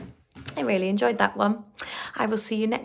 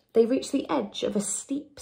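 ordinary delivery, nothing marked

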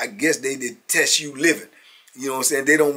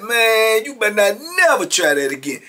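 warning; 8 semitones higher, 10 dB louder than usual. Retold or imitated. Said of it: "I'm like".

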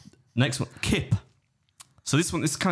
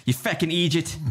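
higher-pitched mock-Irish jibe; Irish accent; 5 semitones higher than usual. Shown as a demonstration. Host showing a guest the Irish insult, mock Irish accent. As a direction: tease in a put-on Irish accent. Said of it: "your best Irish accent".

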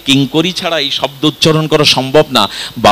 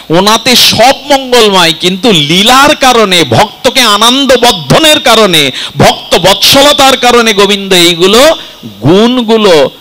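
high, ringing sermon climax; impassioned preaching; 5 semitones higher, 4 dB louder than usual. Real, not acted. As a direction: preach it with rising fervour, pitch held high. (আবেগে উঁচু সুরে কীর্তনীয়ার মতো প্রবচন)

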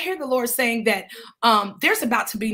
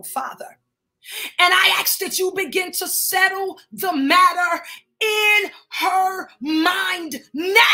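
raised, forceful prayer voice; fervent prayer; 4 semitones higher than usual. Real, not acted. Praying aloud to God over a grieving woman, fervent prayer.

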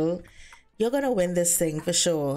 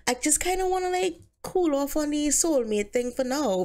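lightly voiced Bobby; 7 semitones higher than usual. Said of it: "she's like".

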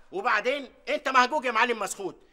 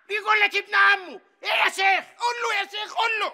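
mocking shout; 8 semitones higher than usual. Merchant egging on, shouting.

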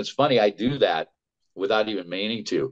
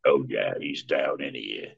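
low alligator character voice; Smiles; 8 semitones lower, 4 dB quieter than usual. Shown as a demonstration. Voice actor demonstrating his alligator on request, low-pitched. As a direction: drop right down into a soft, low alligator. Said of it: "alligator voice".

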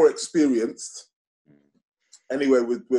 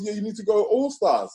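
mock friend's voice; 10 semitones higher than usual. Friend insisting.

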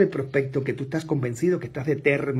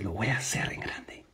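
intense whispered promise; 5 semitones lower, 5 dB quieter than usual. Retold or imitated. Salesman whispering his vow into the prospect's eyes. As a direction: breathe it with lion-eyed resolve. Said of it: "le dice".

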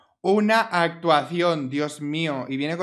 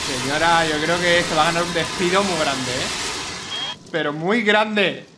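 louder, stern mock telling-off; mock scolding; 4 dB louder than usual. Real, not acted. Player scolds the Sim lady, louder mock stern. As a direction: wag a finger at the lazy Sim.

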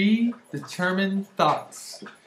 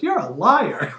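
louder, higher playful accusation; punchy retold exclamation, pitched up; mock accusation; 4 semitones higher, 6 dB louder, slower than usual. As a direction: imitate a mom calling someone out.

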